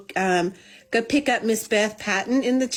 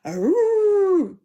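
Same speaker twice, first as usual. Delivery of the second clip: high, drawn-out growling howl; mock dog howl; 11 semitones higher, 3 dB louder than usual. Telling viewers what she expected a dog to sound like, mock howl. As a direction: imitate the dog's long howl. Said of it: "a dog that would go".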